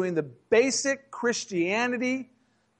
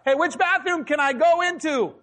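high-pitched calling out to a whole room; mock yelling out; 8 semitones higher than usual. Preacher calling out to a restaurant, mock yell. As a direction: holler it high, as a comic public question. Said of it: "I just start yelling out".

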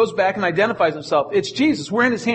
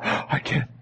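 shocked whisper; 5 semitones lower, 6 dB quieter than usual. Retold or imitated.

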